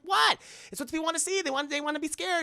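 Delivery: high-pitched indignant protest; mock defensive. Prankster defending her scare to friends, high indignant protest.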